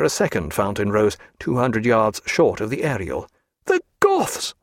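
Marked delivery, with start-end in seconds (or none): 3.7-4.5 very high, indignant exclamation; high-pitched burst of indignation; angry cry. A narrator's reading. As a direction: cry out in outrage, pitch shooting up. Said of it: "cried Stephen, glaring angrily".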